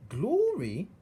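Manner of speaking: very high, loud rising wonder; amazed servant girl. Acted. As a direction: gasp the word in rough awe. Meg amazed.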